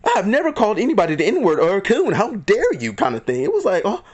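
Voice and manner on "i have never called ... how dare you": higher-pitched mock outrage of an onlooker; shocked. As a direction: act out a scandalized bystander. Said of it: "in shock mode".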